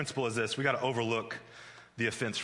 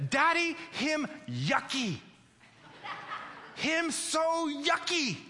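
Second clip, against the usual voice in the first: put-on very high, slow, outraged little girl; redlining toddler; 13 semitones higher, much slower than usual. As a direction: draw out a toddler's disgust, high. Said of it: "she's redlining at that point".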